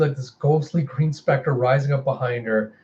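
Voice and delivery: usual speaking voice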